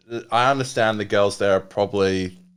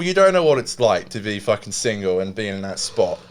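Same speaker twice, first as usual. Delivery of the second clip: ordinary talk, nothing marked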